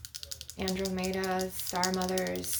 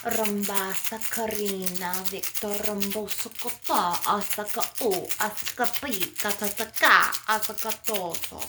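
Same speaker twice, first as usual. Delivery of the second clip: rhythmic chant with punched syllables and a trilled end; channelled light language. Acted. Channelling star mothers coming through, rhythmic incantation. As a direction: drive the incantation on in rhythmic bursts.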